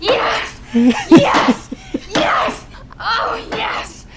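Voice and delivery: high, loud cries of pretended ecstasy; faked climax. Shown as a demonstration. Woman proving her point to a doubting friend, crying out in faked ecstasy. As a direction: go all out with gasping, rising cries. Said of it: "that they fake orgasm".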